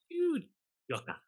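high scoffing you joker; mocking disbelief. Mother's look put in words.

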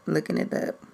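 whispering while searching; whispered to self. Real, not acted. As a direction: mutter under breath. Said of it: "I'm looking it up".

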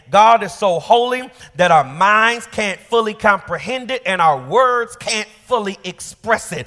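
high, loud preaching swell; rising sermon fervor; preached angels' words. Retold or imitated. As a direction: lift the angels' meaning into a cry. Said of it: "The angels are saying".

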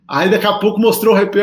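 ordinary talk

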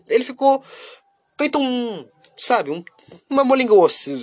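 squeaky excited. Real, not acted. Describing the collapsed pudding.

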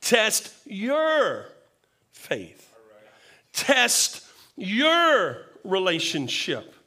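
YOUR drawn out and emphatic, pause after; stress on YOUR. Shown as a demonstration. Preacher stressing personal responsibility to congregation, emphatic long YOUR. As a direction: lean hard and long on "your" each time. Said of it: "places emphasis on personal responsibility".

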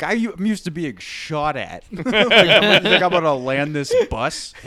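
half-laughing take on a gruff veteran pilot; retelling a comedy bit as the pilot, laughing; Bill Burr pilot bit. Retold or imitated. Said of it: "great Bill Burr bit".

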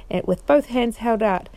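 plain reading voice

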